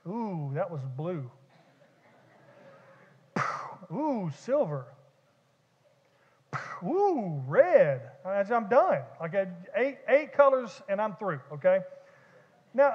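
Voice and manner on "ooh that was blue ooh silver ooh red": lightly mocking oohs at each colour; mock fireworks wonder. Pastor mimicking himself at a fireworks show, mock wonder. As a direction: act out a bored fireworks watcher, ooh by ooh. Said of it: "here's my take on fireworks".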